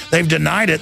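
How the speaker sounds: ordinary talk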